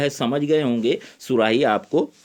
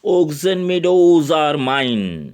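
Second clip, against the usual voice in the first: slow read; 4 dB louder, much slower than usual. Acted. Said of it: "उसे कस के भींचता है".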